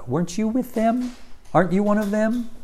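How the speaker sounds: high, pointed accusing question; a bystander catching Peter out. Acted. Bystander at the fire accusing Peter, high and pointed.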